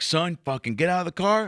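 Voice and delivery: lightly acted cop ordering someone out; agitated cop. Cop ordering the kid out of the car, agitated. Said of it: "this cop is like".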